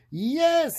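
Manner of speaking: high, loud eager yes; cried yes. Acted. Witch welcoming the dog aboard, high bright cry. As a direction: call out a happy yes to the dog. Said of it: "cried the witch".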